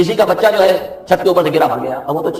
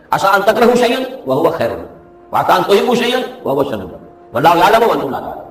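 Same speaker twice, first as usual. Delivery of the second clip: Quranic recitation; 4 semitones higher than usual. Acted.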